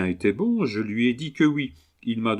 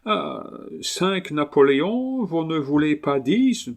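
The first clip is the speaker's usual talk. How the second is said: higher-pitched Englishman speaking broken French; English accent; 7 semitones higher than usual. Acted. Englishman haggling over his new dog, English accent. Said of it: "l'accent anglais".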